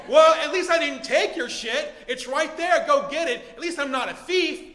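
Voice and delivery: defensive anger. Acted. Owner justifying himself to the thief, defensive and loud. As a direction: argue back, claiming the high ground.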